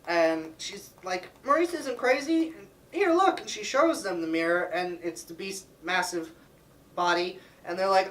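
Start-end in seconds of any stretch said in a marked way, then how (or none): 1.4-3.4 high-pitched mock-indignant protest; insistent heroine. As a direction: plead her case in a high girlish voice. Belle pleads with townspeople.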